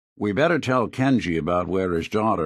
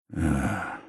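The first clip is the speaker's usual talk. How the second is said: weary sigh; 5 dB quieter than usual. Acted. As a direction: let out a tired sigh. Weary sigh after painful admission.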